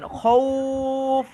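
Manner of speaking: খৌফ with the ঔ held for one alif, slowly; stretched vowel. Shown as a demonstration. Teacher demonstrating recitation to the class, drawing out খৌফ. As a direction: draw out the middle of খৌফ for about a second. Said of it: "আমি কিন্তু একটু টান দিচ্ছি".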